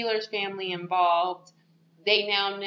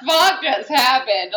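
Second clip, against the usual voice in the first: incredulous; 4 semitones higher, 9 dB louder than usual. Retold or imitated. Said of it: "You're like".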